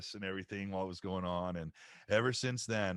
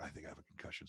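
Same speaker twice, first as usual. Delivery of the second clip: dazed quiet; 6 semitones lower, 13 dB quieter, faster than usual. Retold or imitated. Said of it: "And I was like".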